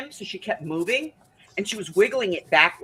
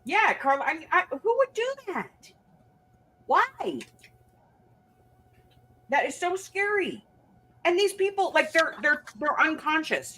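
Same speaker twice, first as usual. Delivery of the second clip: shocked disbelief; 12 semitones higher than usual. Real, not acted. Shocked outrage at the clinic.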